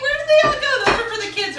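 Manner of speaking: loud, high-pitched disbelief; shocked outcry. Retold or imitated. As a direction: cry out where did the food go. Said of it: "I'm like".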